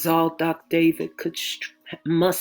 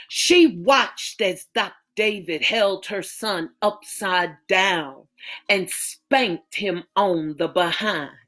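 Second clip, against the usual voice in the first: plain reading voice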